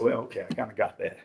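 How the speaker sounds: low, soft resigned mumble; resigned deadpan. Retold or imitated. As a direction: shrug it off, deflated. Deadpan reply to barber.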